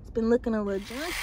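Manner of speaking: ordinary talk, nothing marked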